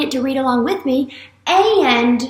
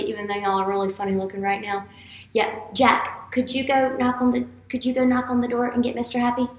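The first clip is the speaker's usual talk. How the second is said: ordinary talk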